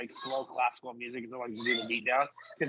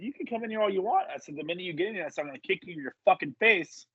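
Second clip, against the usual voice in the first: higher-pitched mock threat; retold threat; 8 semitones higher, 4 dB louder, faster than usual. Wrestler warning a heckler off, retold threat. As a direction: retell it as an indignant warning.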